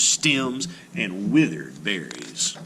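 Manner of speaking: plain reading voice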